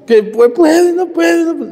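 high, loud hammed-up weeping, slowed; mock sobbing lament. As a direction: imitate a widow wailing, loud and high. Widow sobs, can't speak.

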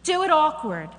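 loud, high, fast preaching climax; preaching at full force. Real, not acted. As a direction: shout the praise to the congregation.

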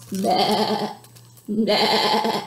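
low bleating sheep noise. Pretend. Librarian voicing the sheep for kids, bleating.

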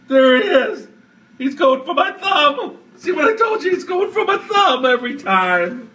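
high shrieking laughter; panicked laughing. Real, not acted. Laughing panic as spider returns.

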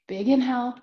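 ordinary talk, nothing marked

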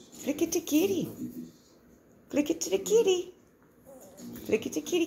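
usual speaking voice

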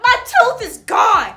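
loud, high, slow tearful yelling at the teacher; crying shout. Retold or imitated. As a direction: wail at her, desperate and loud. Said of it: "I'm crying right now".

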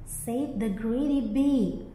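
plain reading voice

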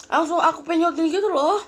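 high, loud excited burst. Real, not acted. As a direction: blurt it out with energy.

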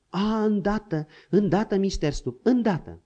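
quick, obliging yes-sir replies; a keen young servant hurrying to obey; eager servant Pluto. A narrator's reading.

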